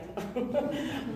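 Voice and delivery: brief breathy laugh at her own joke; short airy laugh. Real, not acted. Joking about looking for band.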